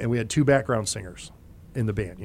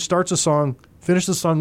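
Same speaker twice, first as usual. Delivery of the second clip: normal talking voice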